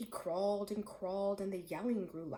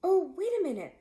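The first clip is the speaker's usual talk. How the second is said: higher, louder quick plea to stop; urgent plea; 9 semitones higher, 10 dB louder, faster than usual. A narrator's reading. Boy stops the hungry lion, urgent high plea. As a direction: cry out to halt the lion.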